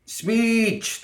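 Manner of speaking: very high, held crowd call; high-pitched gremlin chant. A narrator's reading. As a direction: squeal it out as a crowd. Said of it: "the gremlins called".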